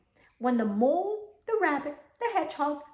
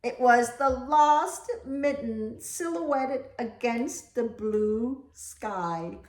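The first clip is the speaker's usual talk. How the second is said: plain reading voice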